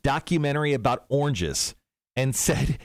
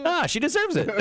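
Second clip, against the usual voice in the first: giggling through the words; laughing; 10 semitones higher than usual. Real, not acted. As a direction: laugh while talking. Laughing while joking.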